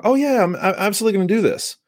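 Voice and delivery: very high, hurried enthusiasm; mock eager commitment. Imitating somebody who commits all day long, mock eager. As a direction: gush an easy promise, hammed-up and high.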